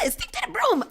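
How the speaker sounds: thin, high shriek held back in volume; retold screaming. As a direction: squeal in reenacted panic. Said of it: "I'm screaming".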